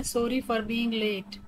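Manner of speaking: very slow, every word separated; robotic slow reading. Shown as a demonstration. Teacher modelling an apology for lateness, slow and robotic. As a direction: spell it out evenly like a machine. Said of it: "थोड़ा robotic वे में पढ़ के बता रही हूँ".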